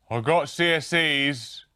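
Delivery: proud blurt. Pretend. Graham spoofed bragging to the interviewer, loud and slow. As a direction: announce it like a proud child.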